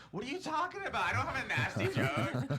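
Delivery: high, loud mock outrage; hammed-up yelling denial; indignant protest. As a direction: protest in exaggerated disbelief, half laughing. Acted indignant denial of accusation.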